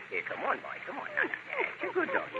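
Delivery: soft coaxing. Acted. Coaxing dog into corner.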